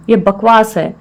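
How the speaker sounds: normal talking voice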